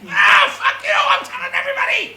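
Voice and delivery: shrieking, very high outburst. Acted. R2-D2 dismissed as cute, furious high shriek. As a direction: scream it in hammed-up fury. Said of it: "He's like".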